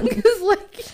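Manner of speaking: high, loud laugh through words; laughing as it spills out. Real, not acted. Laughing after story.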